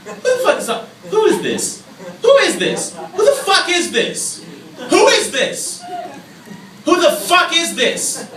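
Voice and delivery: high-pitched shouting, building; mock outraged demand. Answering an unknown caller who won't speak up, mock outrage.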